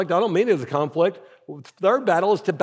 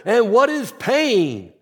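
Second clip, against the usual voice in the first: slow mock shout, pitched up; retold coach's shout; 4 semitones higher, 3 dB louder, slower than usual. Coach quizzing his team, slow mock shout. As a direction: imitate a coach hollering a drill question, stretched out. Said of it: "Coach Boone shouts at him".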